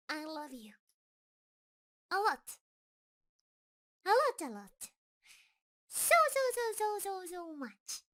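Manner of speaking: building loud and high, then falling away; can't contain it, then it fades; a rush of adoring repeats that fades out; excited confession. Acted. Creature confessing love, overflowing.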